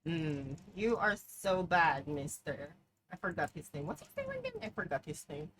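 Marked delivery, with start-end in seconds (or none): 3.9-4.5 sudden very high quiet question; high squeak. Real, not acted. Gamer squeaks a question mid-game.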